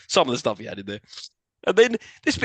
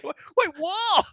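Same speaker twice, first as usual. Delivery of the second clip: much higher, incredulous squeak; outraged, can't believe it; shocked disbelief; much slower than usual. Real, not acted. Outraged at film excuse.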